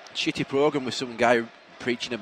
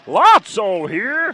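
Lotso voice; 4 semitones higher, 4 dB louder, much slower than usual. Acted. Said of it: "best Lotso impression".

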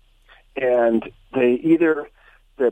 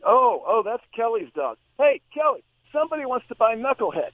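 higher, lightly voiced pound worker calling to Kelly; a pitched-up call across the pound; pound employee calling out; 8 semitones higher than usual. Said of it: "And he said".

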